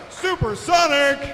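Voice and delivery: high, loud and slow, drawn out; winner shout. Real, not acted. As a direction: belt the winner's name. Winner announced after pit.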